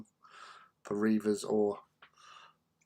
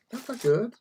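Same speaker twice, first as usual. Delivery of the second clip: much higher, rising: "That's not good."; mock dismay; 12 semitones higher, 6 dB louder than usual.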